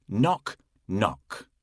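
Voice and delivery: plain reading voice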